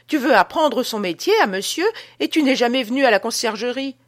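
high, loud wife keeps scolding. Acted. Wife mocks her husband before the visitor, high and loud. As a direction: scold him for pretending he knows it. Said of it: "dit-elle".

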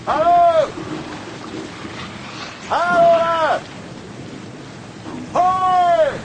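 very high, loud long-distance hail; calling out. Acted. Calling into the night for someone, shouted hail. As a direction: holler out across the water.

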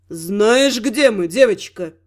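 low, rolling god voice; dark god voice. Acted. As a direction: let it roll through the cave. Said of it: "голос Тёмного".

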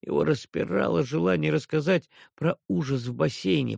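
ordinary delivery

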